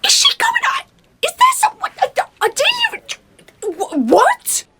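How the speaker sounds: very loud, high, stammering shriek; high-pitched stunned yelling; shocked outburst. Acted. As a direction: splutter it out in shrill disbelief. Frantic shock at gossip.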